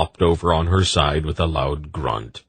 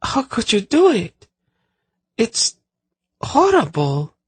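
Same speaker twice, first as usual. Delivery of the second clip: high, queasy distressed voice; sick voice. A narrator's reading. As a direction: sound sickened and pleading. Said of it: "she said in a sick voice".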